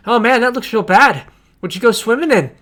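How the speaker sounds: loud, high disgusted NPC; a disgusted dockhand recoiling at the stench; [name]'s dismay. Acted. Dockhand recoiling from the smell, loud and high. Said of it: "and he's going".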